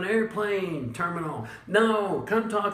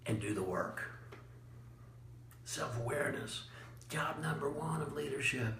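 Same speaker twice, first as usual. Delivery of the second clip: quiet; 2 semitones lower, 12 dB quieter than usual. Acted. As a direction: drop to a near-murmur for weight. Closing point dropped low.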